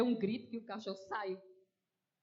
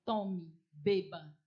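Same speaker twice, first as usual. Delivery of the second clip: louder, very slow, spiteful; mock spiteful offer; 3 semitones lower, 8 dB louder, much slower than usual. Preacher acts out a spiteful person offering poison, slow and louder. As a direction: hand over the poison with slow venom. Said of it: "Aí eu tô com muita raiva".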